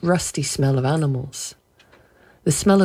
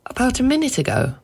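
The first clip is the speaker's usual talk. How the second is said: higher, fast anxious question; 9 semitones higher, faster than usual. Acted. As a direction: rush it out nervously. Wife presses anxiously.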